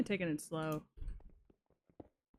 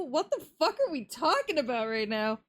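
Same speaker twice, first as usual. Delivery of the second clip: baffled outburst; 6 semitones higher, 5 dB louder than usual. Real, not acted. Baffled by chat's polar bear talk.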